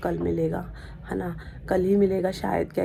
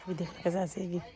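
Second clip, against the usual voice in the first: usual speaking voice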